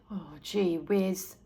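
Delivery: put-on low, soft, slow sigh of a fed-up boy; a lightly voiced bored brother. A narrator's reading. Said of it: "He sighed".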